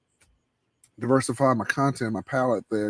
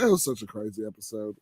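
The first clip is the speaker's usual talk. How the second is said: ordinary talk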